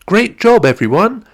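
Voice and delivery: bright, high cheer of praise. Acted. Zoe praising cheetahs after their jumps, high excited cheer. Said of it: "Zoe cheered".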